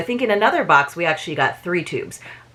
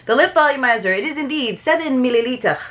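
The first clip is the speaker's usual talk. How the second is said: mock-French accent, pitched higher; higher-voiced playful French accent; 6 semitones higher than usual. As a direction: put on a French accent for the product details. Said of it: "Why am I speaking French?".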